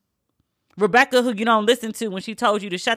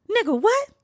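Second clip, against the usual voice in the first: much higher, stunned squeak; incredulous; 12 semitones higher than usual. Real, not acted. Hearing the hog proverb, high incredulous squeak. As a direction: react in utter disbelief.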